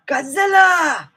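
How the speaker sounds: high, loud cry of the monster's name; excited shout. Acted. Asking about a real Godzilla, excited shout. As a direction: shout it like a monster-movie sighting.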